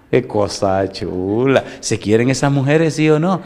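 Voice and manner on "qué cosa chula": tender. Real, not acted. Tender reaction after mother demo.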